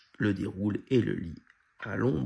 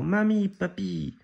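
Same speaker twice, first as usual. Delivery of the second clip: high, loud, drawn-out happy call; excited calling out; 10 semitones higher, 6 dB louder, slower than usual. A narrator's reading. Cousins rushing at their grandparents, calling out excitedly. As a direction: stretch both names out in a joyful shout. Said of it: "s'écrient les cousins en se ruant sur eux".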